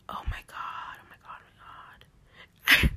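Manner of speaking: breathless horrified whisper; shocked whisper. Real, not acted. Vlogger cringing at her old edits, whispering in horror. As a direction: whisper it like you can't bear to look.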